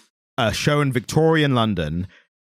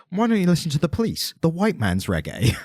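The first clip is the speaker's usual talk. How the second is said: mock suggestion; 6 semitones higher than usual. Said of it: "someone was like".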